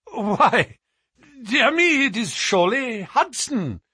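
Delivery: high, loud cry of recognition; surprised. A narrator's reading. Trevor recognising the sailor Hudson, in loud surprise. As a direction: exclaim it high, in astonishment. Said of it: "said Mister Trevor in a tone of surprise".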